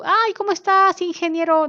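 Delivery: high, loud, breezy hello to the boss; chummy greeting. Retold or imitated. Employee greeting the engineer boss informally, high and chummy. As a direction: gush it like an overly friendly coworker.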